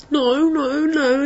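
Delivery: brother denying. Retold or imitated. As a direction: protest like a kid caught out. Brother denies to mum.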